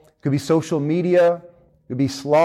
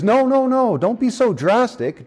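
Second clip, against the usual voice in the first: high, pleading no no no; the old man's voice jumps high in alarm; panicked plea; 9 semitones higher than usual. Acted. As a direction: beg him not to go so far. Man begs angel to spare lizard.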